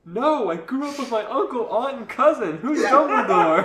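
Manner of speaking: put-on high, loud boy's protest, breaking up; exasperated boy character. A narrator's reading. Boy Harry protests to the girl, exasperated high voice. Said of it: "Harry answered".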